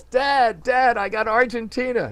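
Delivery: put-on high, loud kid's shout of triumph; excited yell. Son shouting to dad about reaching Argentina, excited yell. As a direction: shout it like a thrilled boy who just made contact. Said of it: "and he yells".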